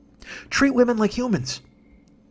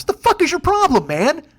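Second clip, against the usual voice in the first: higher, sudden furious burst; angry outburst; 7 semitones higher, 3 dB louder than usual. Real, not acted. Ranting at the pancake house shooter, an angry higher burst. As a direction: snap it out at him in real anger.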